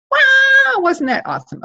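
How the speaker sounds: high delighted wow, then an excited question. Real, not acted. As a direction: gush with delight.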